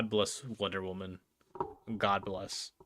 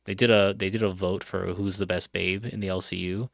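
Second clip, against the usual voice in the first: ordinary delivery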